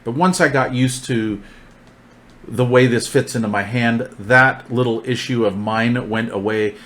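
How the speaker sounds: ordinary talk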